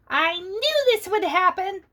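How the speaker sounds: high squeaky mouse voice; mouse squeal. Acted. The mouse squealing as the tree sways, high. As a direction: squeal it like a panicked little mouse. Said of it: "the mouse squealed".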